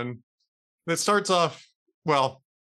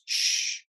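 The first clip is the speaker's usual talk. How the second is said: unvoiced "shh"; long, acted shushing sound; hushing. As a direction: hush a child in hiding. Man hushing girl in closet.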